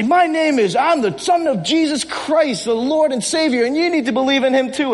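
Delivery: mock heroic declaration. Believer answering a stranger on the street, mock heroic declaration. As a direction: declare it like a film hero naming himself. Imagines answering like the film hero.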